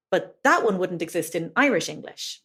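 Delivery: usual speaking voice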